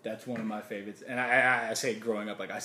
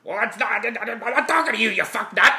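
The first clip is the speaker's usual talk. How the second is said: higher, louder sputtering fury; 10 semitones higher, 12 dB louder than usual. Retold or imitated. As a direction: sputter out an angry comic outburst. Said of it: "who's always like".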